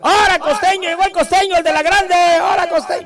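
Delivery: high, loud cheering shout; fans shouting. Retold or imitated. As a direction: yell it like fans greeting him in the street. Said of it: "me empiezan a gritar".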